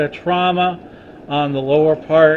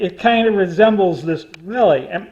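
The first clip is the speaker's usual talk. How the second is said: usual speaking voice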